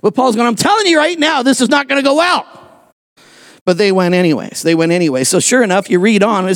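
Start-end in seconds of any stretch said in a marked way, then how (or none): 0.5-2.4 high, urgent-sounding warning; acted insistent warning. Paul warning crew the voyage will fail, insistent. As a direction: push the warning out high and emphatic.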